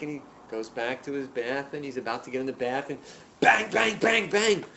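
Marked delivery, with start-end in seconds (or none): 3.4-4.6 loud, high, punched repeats; imitated banging. Visitor interrupting Hillel's bath, loud imitated banging. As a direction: hammer each word like a fist on wood.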